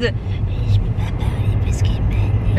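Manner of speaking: slow whisper, pretending to have lost her voice; hammed-up sick whisper, barely voiced; mock sick whisper. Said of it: "et je suis pas en mode".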